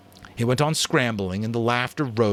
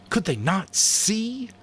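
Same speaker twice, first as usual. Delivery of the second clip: Ralph's despair; 13 semitones higher, 3 dB louder, slower than usual. Acted. Ralph's inner anguish.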